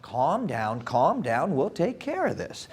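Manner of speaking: a soft, calming teacher voice; lightly voiced teacher calming the class; soothing teacher. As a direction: quietly settle the kids down. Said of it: "Missus Baird was standing there going".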